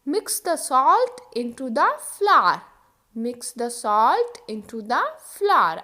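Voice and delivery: mix the salt into the flour said very slowly; slow model sentence. Shown as a demonstration. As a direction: drill it slowly twice. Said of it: "example देखो". (धीरे-धीरे बोलें)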